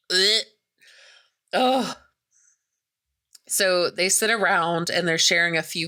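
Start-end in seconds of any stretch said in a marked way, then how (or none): 0.1-2.0 short higher-pitched "ugh"; disgusted grunt. Retold or imitated. Jeff watching the vine get cut out, disgusted grunt. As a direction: imitate a grossed-out onlooker's grunt. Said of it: "you see him, like".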